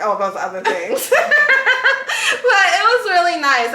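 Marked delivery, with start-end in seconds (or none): 1.1-2.2 high, loud laugh. Real, not acted. Joking about church and sin, high laugh. 2.3-3.7 laughing. Real, not acted. Laughing after joke.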